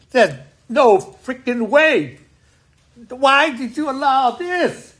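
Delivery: slow, high, pleading outrage; anguished rant at God. Retold or imitated. Retelling how he raged at God over the deaths, slow and high. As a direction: cry out at God asking why he allowed it. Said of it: "I'm going".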